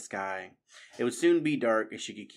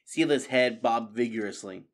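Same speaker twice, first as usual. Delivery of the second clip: plain reading voice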